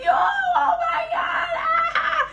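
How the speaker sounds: high, slow shrieking laugh; howling laughter. Retold or imitated. The bros shrieking with laughter at their friend. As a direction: cackle it out in hysterics. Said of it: "They're like".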